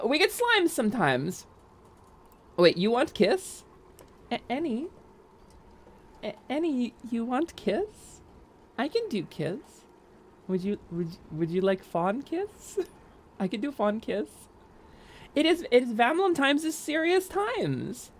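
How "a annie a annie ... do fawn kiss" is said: put-on baby talk. Offering kisses to Annie.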